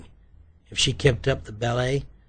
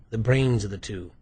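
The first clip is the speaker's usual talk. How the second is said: ordinary delivery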